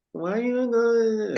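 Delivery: high, slow whiny plea; whiny woman voice. Retold or imitated. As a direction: whine it like a clingy girlfriend. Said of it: "this wasn't the whiny".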